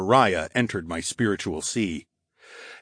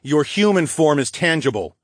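raised, smug reply; smirking; 6 semitones higher, 3 dB louder than usual. A narrator's reading. Hero answers the trapped wraith, smirking. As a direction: say it through a smirk. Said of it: "I replied with a smirk".

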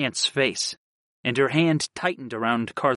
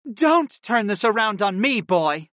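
snarl; 7 semitones higher than usual. A narrator's reading. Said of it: "Kristen snarled at me".

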